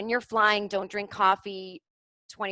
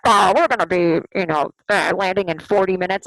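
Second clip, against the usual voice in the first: very loud, hesitant cockpit announcement; pilot announcement; 2 semitones lower, 10 dB louder than usual. Retold or imitated. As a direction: imitate a pilot stalling over the intercom. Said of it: "And the pilot was like".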